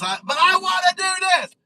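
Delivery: high, loud whiny protest; mock protest shout. Imitating himself protesting to the boss AI, loud and high. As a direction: cry out like a kid who wants his way. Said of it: "It's like".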